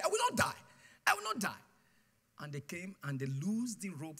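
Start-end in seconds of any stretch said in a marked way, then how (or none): 0.0-1.6 repeated declaration. Retold or imitated. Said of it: "began to say".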